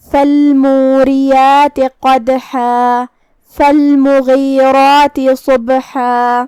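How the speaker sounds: slow, drawn-out melodic tajweed chant; Quran recitation. Shown as a demonstration. Teacher recites the verse to students in a slow melodic chant. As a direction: recite each word slowly and melodically, letting the vowels ring.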